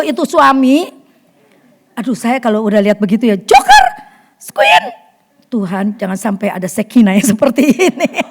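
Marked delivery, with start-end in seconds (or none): 3.4-5.1 very high, loud call to the dogs. Retold or imitated. Owner calling Joker and Queen to stop fighting. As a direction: shout the names to break up a dog fight.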